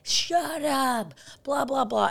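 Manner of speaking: high-pitched mock yell, kept under full volume; retold drunken scream. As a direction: imitate a drunk screaming without shouting. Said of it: "starts screaming at us".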